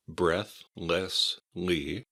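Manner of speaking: "breathlessly" very slowly, in three separate syllables. Shown as a demonstration. Teacher breaks the word into syllables.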